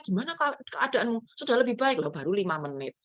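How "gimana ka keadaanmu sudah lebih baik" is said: a hint of a fussing mother, higher; mildly anxious parent checking in by phone. Mother phoning her sick child every five minutes, mildly anxious. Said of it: "Sekarang tiap lima menit dicek".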